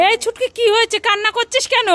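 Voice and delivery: high, worried questioning; alarmed concern. Acted. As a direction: ask urgently what is wrong. Mother alarmed at crying child.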